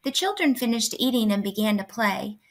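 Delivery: ordinary delivery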